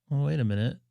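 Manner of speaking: ordinary talk, nothing marked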